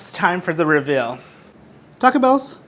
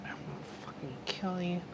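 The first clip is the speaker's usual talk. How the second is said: very soft, seething under the breath; muttered threat; 16 dB quieter than usual. Real, not acted. Host threatening the camera that failed, muttered threat. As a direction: mutter it almost inaudibly, menacingly.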